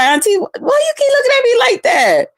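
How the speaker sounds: imitating the niece; niece's voice. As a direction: squeak it like a startled young niece. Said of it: "She's like".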